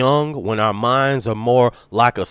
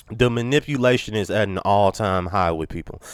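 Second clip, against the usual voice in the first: ordinary talk, nothing marked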